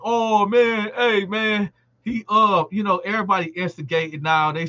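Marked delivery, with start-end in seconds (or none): 0.0-1.7 higher, egging-on hoots; instigating crowd. Retold or imitated. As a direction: play the inmates hyping the fight. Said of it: "So everybody like".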